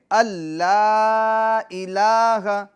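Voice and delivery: اللہ الہ with the laa held long; madd stretched. Shown as a demonstration. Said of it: "لا کو کیوں کھینچیں گے".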